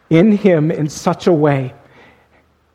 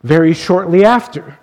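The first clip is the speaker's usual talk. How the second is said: ordinary delivery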